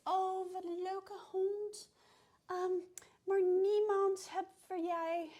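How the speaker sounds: high sing-song voice cooing at a dog; sing-songy Dutch lady. Retold or imitated. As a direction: mimic a woman cooing sweetly to a dog. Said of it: "she's talking in a sing-songy voice at the dog".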